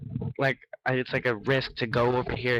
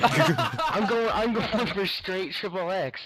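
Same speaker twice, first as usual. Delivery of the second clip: laughing; 7 semitones higher, much slower than usual. Real, not acted. Joking, laughing while saying it.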